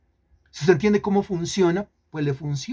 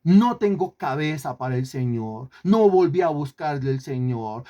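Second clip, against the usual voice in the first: usual speaking voice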